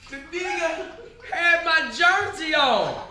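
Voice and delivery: slow, high, loud, through laughter; laughing disbelief. Retold or imitated. Retelling the thief's nerve, laughing. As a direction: draw it out, cracking up.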